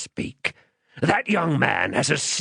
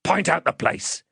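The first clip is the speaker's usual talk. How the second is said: higher, threatening demand; menacing man; 7 semitones higher than usual. Acted. Man demanding the child's name, menacing. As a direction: play a rough interrogator bullying a child.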